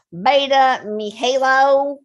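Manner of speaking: hammed-up Texas drawl on the name, Mihalo stretched slow; Texas accent. Host reading a viewer's name, in a mock Texas accent. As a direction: drag the name out like a Texan. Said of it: "I could do it in Texas accent".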